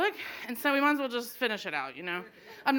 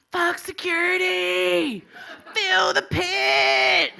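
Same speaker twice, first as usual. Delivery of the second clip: slow, drawn-out higher shout; 7 semitones higher, 5 dB louder, much slower than usual. Retold or imitated. Rapper yelling at the crowd to rush the pit, stretched-out shout. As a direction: bellow it out like a rapper hyping the crowd.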